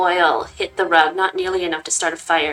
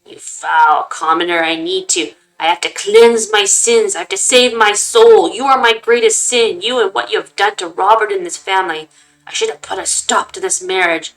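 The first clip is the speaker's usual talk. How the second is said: loud, raging rant, slowing at times; furious old man; 8 dB louder than usual. Acted. Grandfather condemning mother in her chambers, raging loud. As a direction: hurl every word at her in fury.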